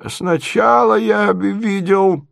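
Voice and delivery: high, slow voice with long halts; doddering prince, pondering. Acted. Prince tries to recall his dream, high and halting. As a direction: grope haltingly through a hazy memory.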